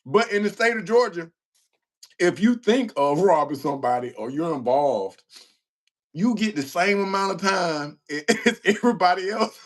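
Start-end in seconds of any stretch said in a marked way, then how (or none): 6.1-9.7 higher, breaking into laughter mid-sentence; real laughter spilling over the words; laughing. Real, not acted. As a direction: try to explain while cracking up. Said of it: "And I'm laughing now".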